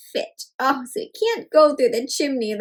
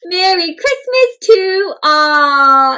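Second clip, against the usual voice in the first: slow, louder hearty holiday call; Santa's call; 3 semitones higher, 5 dB louder, much slower than usual. A narrator's reading. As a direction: call out cheerily.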